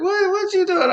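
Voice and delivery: high-pitched mock mother's question; alarmed mom. Mother confronts boyfriend over crying child, mock alarm.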